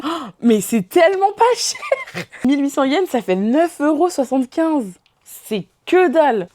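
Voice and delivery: stunned by how cheap it is; delighted surprise. Real, not acted. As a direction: gush over a bargain. Delighted at the low price.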